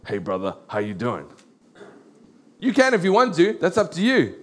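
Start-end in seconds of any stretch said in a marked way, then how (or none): 0.1-1.3 much lower, softer, jokey bro voice; preacher mocking an overfamiliar greeting in a low voice. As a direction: drop deep for a lazy churchy hey brother. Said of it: "go up to everyone and say".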